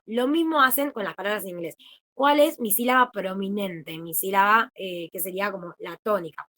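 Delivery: ordinary talk, nothing marked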